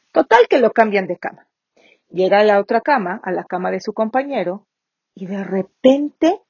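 normal talking voice

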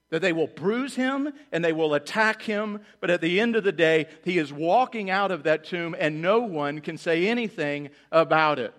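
full-force sermon peak; preaching climax. Real, not acted. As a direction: rise to a triumphant shout.

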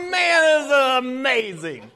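hammed-up ecstatic shout, drawn out; overjoyed whoop. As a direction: slowly crow it like a sudden millionaire. Acts out man given a million.

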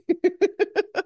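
bright high burst of laughter. Real, not acted. Laughs after joke about singing.

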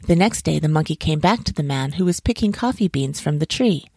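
usual speaking voice

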